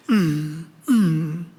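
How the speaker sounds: low closed-mouth "mm"; mock a polite, stifled groan; composed low hum in place of screaming; dignified restrained groan. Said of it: "인격적으로".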